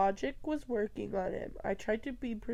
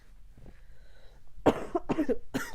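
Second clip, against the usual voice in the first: coughing fit; 8 semitones higher than usual. Real, not acted. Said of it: "literally coughing".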